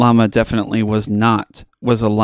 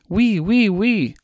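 high, bouncy oui oui; mock French alpacas; 10 semitones higher, slower than usual. A narrator's reading. Alpacas in a French restaurant, chirping high. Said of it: "shouted the alpacas".

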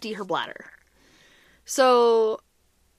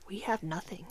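soft, low whispered disbelief; stunned whisper; 4 semitones lower, 12 dB quieter than usual. Acted. Mom realizes the baby's due soon, stunned whisper. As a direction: whisper it as if it just sank in. Stunned, no baby things ready.

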